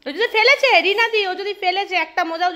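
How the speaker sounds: high, loud mock warning; high mock-stern if he drops it; mock stern threat. Real, not acted. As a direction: playfully threaten the dog. Threatening playful scolding.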